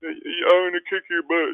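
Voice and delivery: high, loud, slow hammed-up threat; mock trash talk. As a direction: put on a mock-tough, playful threat.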